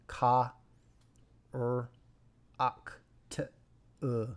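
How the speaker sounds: 'ka, er, ak, t, uh' in separate little pieces; French syllable breakdown. Shown as a demonstration. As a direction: sound out French 'character' piece by piece. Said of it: "more in French".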